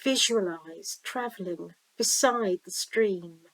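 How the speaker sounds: slow-paced visualization reading with long pauses; guided meditation. Acted. Reader guiding listeners down a stream to the sea, guided meditation. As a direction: lead the listener gently, leaving room to imagine.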